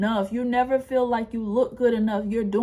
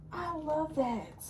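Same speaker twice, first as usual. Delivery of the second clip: "I love that" said slowly and much softer, almost breathed out; 6 semitones higher, 11 dB quieter, slower than usual. Shown as a demonstration. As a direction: let "I love that" fade to a slow, hushed murmur. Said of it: "repeated, second fades away".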